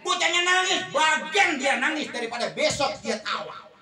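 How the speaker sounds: loud, high preaching, slowing on tega and nangis; fiery preaching. Acted. Preacher urging parents to harden their hearts, full sermon force. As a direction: drive it home like a preacher.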